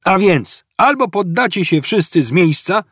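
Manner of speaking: high-pitched, insistent police ultimatum; calling out. A narrator's reading. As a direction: shout your terms to the fugitives. Cop shouting terms across the hall. (ultimatum wykrzyczane wysokim głosem)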